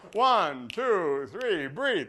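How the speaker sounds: loud count-in before the breath. Shown as a demonstration. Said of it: "Here we go".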